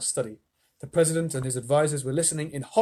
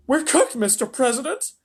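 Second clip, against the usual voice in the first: higher, loud radio shout; shouting astronaut; 11 semitones higher, 5 dB louder than usual. A narrator's reading. Shanks shouts to the president, higher and loud. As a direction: yell the report. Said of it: "shouted Shanks".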